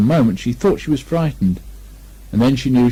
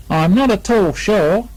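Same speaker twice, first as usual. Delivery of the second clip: slow, higher, musing Kanga; lightly voiced thoughtful Kanga; 9 semitones higher, 4 dB louder, slower than usual. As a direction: think it over slowly. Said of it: "in a thoughtful voice".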